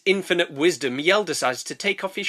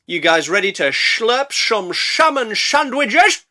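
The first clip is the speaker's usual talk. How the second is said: slow, loud and higher dude voice; dudebro camper voice; 5 semitones higher, 5 dB louder, slower than usual. Acted. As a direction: sell it like a goofy college camper. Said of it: "And Yell says".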